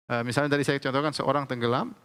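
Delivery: ordinary talk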